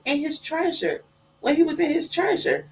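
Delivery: put-on high little boy explaining; child voice. Boy tells mama where he saw Jonah, high child voice. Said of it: "He said".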